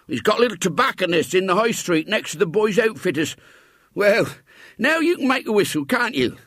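put-on high, creaky old countryman voice; old man coaxing the boy, pitched high. A narrator's reading. Old man's voice in story.

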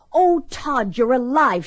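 high, emotional cry of a novel heroine. A narrator's reading. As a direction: read her line as an overjoyed outcry. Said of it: "she cried".

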